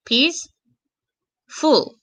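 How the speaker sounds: "peaceful" slowly split in two with a long gap; two separate beats on "peaceful", second one falling; syllable by syllable. Shown as a demonstration. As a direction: count out "peaceful" as peace... ful. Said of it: "So two adjectives. So one".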